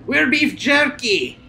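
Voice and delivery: high, slow exclaim; mock horror. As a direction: overplay the shock. Reacting to ugly new character.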